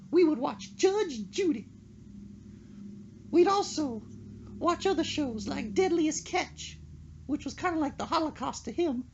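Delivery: high-pitched mock-solemn old lady eulogy. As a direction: deliver the fish eulogy as her, overly earnest. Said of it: "She's like".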